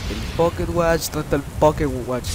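ordinary delivery

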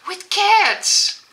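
high, loud burst of delight; delighted squeal. Real, not acted. Vlogger spots cats on gifted socks, delighted squeal. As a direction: squeal it out, really thrilled.

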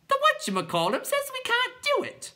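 very high squawky clown; clown creature voice. A narrator's reading. Clown informing the other clowns, very high voice. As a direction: pipe it out in a silly high voice.

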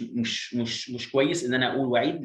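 usual speaking voice

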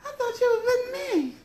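very high laughing wail. Retold or imitated. Quoting movie line.

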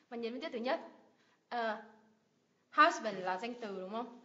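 normal talking voice

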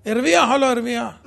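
laughing. Real, not acted. Laughs at outcome.

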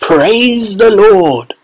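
put-on drawn out like a rejoicing old man; jubilant cry. A narrator's reading. Grandfather thanking heaven, slow jubilant cry.

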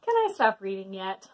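usual speaking voice

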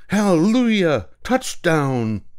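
high, loud cheer of joy; ecstatic shout. Acted. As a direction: celebrate like a touchdown. Mike going berserk over football.

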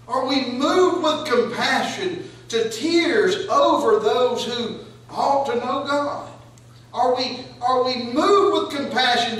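loud pleading preacher's climax; full-force preaching. Acted. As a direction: hammer each "Do we weep" home.

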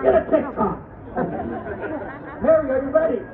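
usual speaking voice

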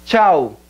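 CHAO as one syllable, "chow"; Portuguese pronunciation. Shown as a demonstration. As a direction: close CHAO with a u glide.

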